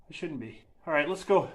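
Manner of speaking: ordinary talk, nothing marked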